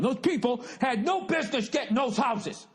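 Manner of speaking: half-laughing impression of the neighbor ranting; lightly voiced old neighbor losing it over housing loans; flipping out. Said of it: "he was flipping out going".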